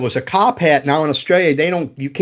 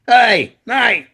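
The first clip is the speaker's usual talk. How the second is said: a loud, higher mock shout of the kids' call; yelled call; 8 semitones higher, 4 dB louder than usual. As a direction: call out across the rocks, imitating the kids. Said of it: "they fucking yell".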